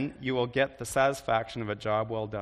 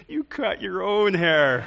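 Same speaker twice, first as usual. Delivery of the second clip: higher, louder, slow scolding; exasperated parent; 6 semitones higher, 6 dB louder, slower than usual. Shown as a demonstration. Parent eyeing kids' haircut, exasperated scolding. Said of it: "as you're probably looking at your kids".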